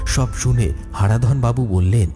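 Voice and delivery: ordinary delivery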